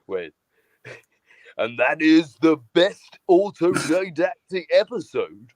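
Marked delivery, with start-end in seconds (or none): 1.6-5.5 mock car-show presenter, slow falling finish; Jeremy Clarkson. Host showing co-host his impression, portentous presenter. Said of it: "I wanted to do that Jeremy Clarkson impression".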